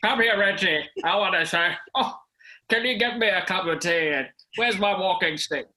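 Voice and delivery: put-on high, creaky elderly man; a put-on old man calling his son. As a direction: play a frail grandad asking for tea. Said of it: "And I'm gonna talk like this".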